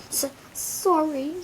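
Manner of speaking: high, slow, broken apology; sobbing. Acted. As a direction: sob the word out. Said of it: "sobbed Hagrid".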